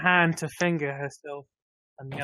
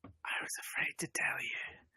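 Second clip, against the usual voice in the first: slow, hushed whisper; 12 dB quieter than usual. Acted. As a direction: whisper it slowly, very softly. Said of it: "she whispers".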